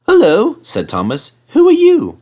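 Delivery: high, loud curious engine; Thomas voice. Acted. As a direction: call out, curious. Thomas greets a bus.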